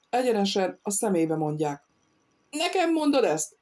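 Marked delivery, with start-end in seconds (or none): 2.5-3.6 a nobleman's affronted outburst; indignant shout. A narrator's reading. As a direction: shout it back in disbelief. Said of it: "kiáltotta".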